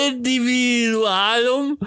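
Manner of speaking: high, slow, laughing through a made-up word; giggling at his own invented word; laughing mid-word. Real, not acted. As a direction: fumble the word, cracking up. Mock-pompous mispronounced word, laughing.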